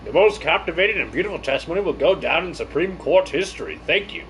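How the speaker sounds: lightly gushing court character. Acted. Official praises the testimony to court, mildly gushing. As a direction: flatter the witness with a hint of pomp.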